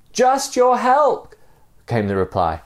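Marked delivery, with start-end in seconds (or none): 0.1-1.3 high, drawn-out call; high-pitched acted plea. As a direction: call back slowly from far below. Reply called up from below.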